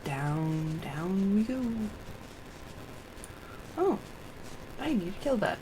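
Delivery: ordinary talk, nothing marked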